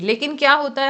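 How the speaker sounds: ordinary talk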